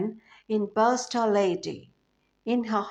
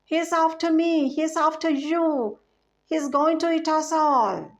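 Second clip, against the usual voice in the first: higher, louder panicked cry, read with flair; a storyteller's frightened dentist, half-acted; mock panicked shriek; 7 semitones higher, 4 dB louder than usual. A narrator's reading. Dentist panics at the crocodile, a higher, louder mock shriek. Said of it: "the dentist shrieked".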